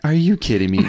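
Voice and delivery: can't believe he got locked in; exasperated disbelief. Retold or imitated. Locked in the locker, calling after friend in disbelief.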